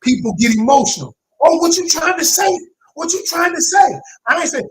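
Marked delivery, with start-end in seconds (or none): 1.4-4.0 high, loud, defensive challenge; mock defensive outburst. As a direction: play the upset partner snapping back. Acted emotional quarrel partner.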